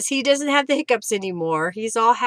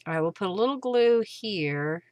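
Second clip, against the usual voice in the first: ordinary talk, nothing marked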